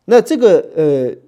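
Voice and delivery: ordinary talk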